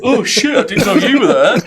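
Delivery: high-pitched mock surprise, laughing at the end; mock startled. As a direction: act caught out, voice jumping up high. Retelling friend caught in toilet.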